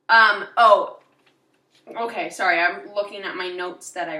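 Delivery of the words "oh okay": ordinary delivery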